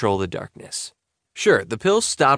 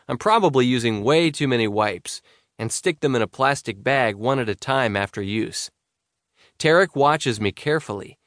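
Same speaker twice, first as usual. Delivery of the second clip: ordinary delivery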